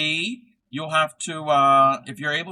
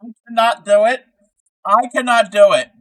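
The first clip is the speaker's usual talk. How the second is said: loud, higher mock Swedish accent; attempted Swedish accent; 9 semitones higher, 12 dB louder than usual. Streamer failing at a Swedish accent like [name]'s, hammed-up and loud. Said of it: "He's trying to do a Swedish accent like [name] does".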